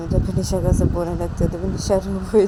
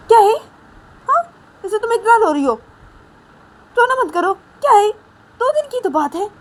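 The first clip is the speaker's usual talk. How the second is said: high-pitched exasperated scolding; scolding mother; 8 semitones higher than usual. Acted. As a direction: tell off a child for crying like a baby. Mother scolding crying Nisha. (ऊँची आवाज़ में झुंझलाकर डाँटना)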